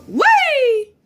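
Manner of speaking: high swooping whee; playful glee. Acted. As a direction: squeal as if tossing a toy up. Toy thrown up in air.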